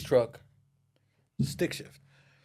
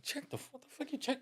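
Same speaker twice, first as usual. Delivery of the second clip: incredulous; 10 dB quieter than usual. Retold or imitated. Baffled at homie's plan.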